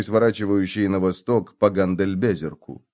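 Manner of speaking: plain reading voice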